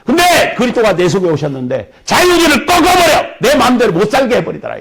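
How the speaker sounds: high, loud sermon cry; fiery preaching. Acted. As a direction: proclaim it with fervour. Preaching climax.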